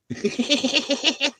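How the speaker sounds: long high-pitched giggle. Real, not acted. Laughing to chat in a long high giggle. Big laugh at own joke.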